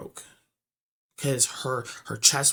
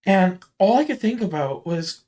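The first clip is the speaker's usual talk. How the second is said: usual speaking voice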